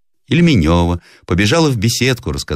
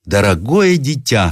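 slow, high anguished cry; outcry; 6 semitones higher, 3 dB louder, slower than usual. A narrator's reading. Mother cries out to her fainted daughter, high and slow. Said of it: "вскричала она".

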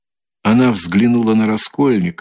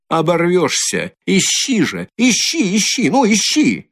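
high, frantic, desperate tirade; hysterical fury; 6 semitones higher than usual. A narrator's reading. Katerina frantic, threatening to run to the Tsar. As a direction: drive it up into hysteria. Katerina daring Luzhin to search.

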